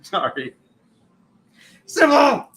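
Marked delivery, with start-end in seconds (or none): 1.9-2.5 loud, high call of her name. Real, not acted. Host calling out to a viewer loud. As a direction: call out to her across the room.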